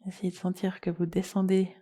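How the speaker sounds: soft, unhurried yoga nidra guidance with long pauses; guided meditation. Acted. Leads a guided relaxation.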